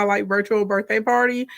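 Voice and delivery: ordinary talk, nothing marked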